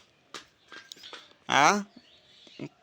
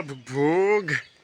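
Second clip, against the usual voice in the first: put-on dog sound for toddler; 3 semitones lower, much slower than usual.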